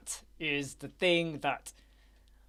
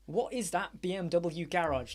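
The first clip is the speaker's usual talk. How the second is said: ordinary talk, nothing marked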